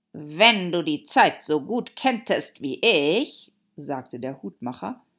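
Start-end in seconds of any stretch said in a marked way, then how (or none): 0.1-3.5 loud, slow Hatter voice; Hatter, reproving. Acted. Hatter correcting Alice about Time, loud and slow. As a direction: reprove emphatically, drawn out.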